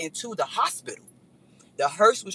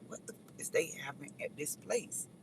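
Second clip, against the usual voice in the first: whisper; 3 semitones lower, 15 dB quieter than usual. Retold or imitated. Stunned whisper to self.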